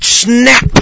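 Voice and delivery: high loud sharp snap; sudden loud mock snap; snap sound. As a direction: crack the word out like a cord yanked. Imitating cord jerking.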